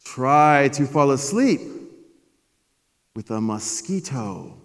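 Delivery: loud, slow, solemn father voice; wise dad. Retold or imitated. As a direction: hand down wisdom like an old sage. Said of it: "He said".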